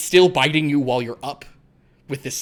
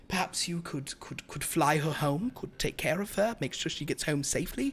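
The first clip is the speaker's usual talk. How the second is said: soft, polite vampire request; courteous vampire; 7 dB quieter than usual. Acted. Vampire asks gargoyle to fly Dahlia safely home, courteous. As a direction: suggest it quietly, with careful courtesy.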